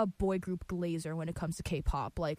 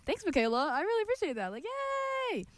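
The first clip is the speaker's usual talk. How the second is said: excited; 12 semitones higher than usual. Real, not acted. Thanking caller after her song.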